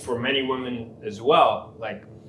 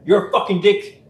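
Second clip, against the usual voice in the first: raised, louder acted outburst; mock angry insult; 8 semitones higher, 7 dB louder than usual. Counsellor voicing a client on a bad day, mock angry. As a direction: lash out as the client, voice pitched up.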